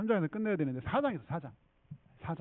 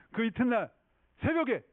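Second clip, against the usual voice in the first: usual speaking voice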